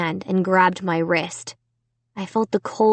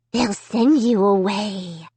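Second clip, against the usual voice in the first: rasped threat. A narrator's reading. Dummy threatening Amy, a slow cold rasp. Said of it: "he rasped".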